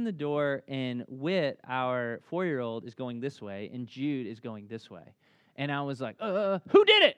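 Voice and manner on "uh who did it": stern demand. Retold or imitated. Dad demands answer after fight.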